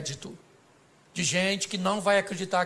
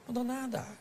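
doubting relatives; 3 semitones higher, 8 dB quieter than usual. Acted.

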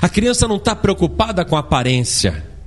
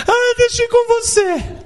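put-on much higher, whiny little kid pleading; child begging father to come along, whiny plea. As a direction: plead like a child who won't be left behind.

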